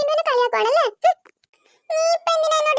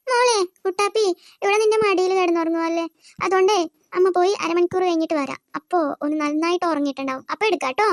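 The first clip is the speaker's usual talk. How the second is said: put-on lower, motherly voice settling the kids; mother's voice; 7 semitones lower than usual. Mother tells the daughter she will be back soon, low and motherly.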